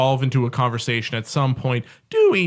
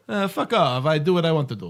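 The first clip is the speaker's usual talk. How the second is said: imitating the Queen; 3 semitones higher than usual. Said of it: "you made the Queen like".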